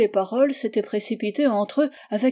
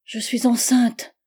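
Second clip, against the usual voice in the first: voix ferme; 3 semitones higher, 4 dB louder, slower than usual. A narrator's reading. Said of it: "parlant d'une voix ferme cependant".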